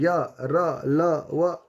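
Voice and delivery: या, रा, ला, वा said again one at a time; syllable demo. Shown as a demonstration. Teacher repeating the letters to show the breath going in. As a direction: repeat या रा ला वा clearly. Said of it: "तो देखिए कि ये बारंबार".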